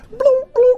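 put-on high piping bloom bloom like an old game beep; video game bleeps. Recalling Pong to the audience, a playful bleep imitation. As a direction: mimic a retro console sound, lightly.

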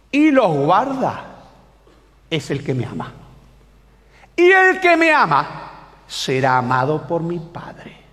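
slow recitation, swelling then dropping; dramatic scripture reading. Acted. Preacher reads Jesus's promise to young people, slow dramatic recitation. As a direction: declaim the verse slowly, alternating force and hush. Reads a Bible verse solemnly.

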